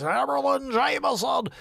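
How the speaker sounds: high, loud mock announcer yell; over-the-top shouted title call; hammed-up title shout. Mimics his own show segment's title.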